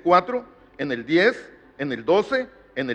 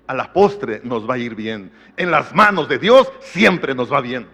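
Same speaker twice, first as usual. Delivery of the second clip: slow assurance; 5 semitones lower, 5 dB louder, slower than usual. Acted. Preacher slows to assure.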